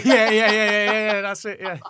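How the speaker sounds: loud, high-pitched laughing agreement. Real, not acted. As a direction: agree helplessly through real laughter. Cracking up at guest's joke.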